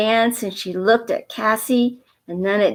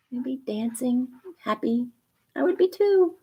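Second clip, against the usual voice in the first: usual speaking voice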